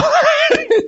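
a burst of high, loud laughter. Real, not acted. Telling a friend about his old self, a high loud laugh.

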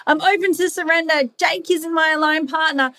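high, bright, eager yes to surrender; the mind cheerfully talking itself into it; eager inner voice. Acted. The mind insisting it feels safe with partner, high and eager. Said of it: "And I can say, like".

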